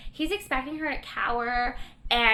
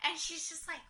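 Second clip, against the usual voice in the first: ordinary talk, nothing marked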